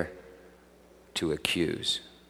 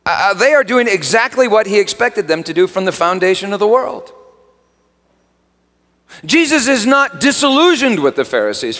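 high, loud, impassioned preaching; preaching at full force; 12 semitones higher, 9 dB louder than usual. Acted. Preacher pressing the congregation on blindness, impassioned preaching. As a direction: drive it home from the pulpit.

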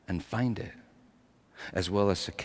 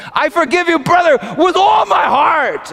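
much higher, louder full-voiced shout; crying out; 17 dB louder than usual. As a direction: cry it out with overflowing emotion. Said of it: "She cried out".

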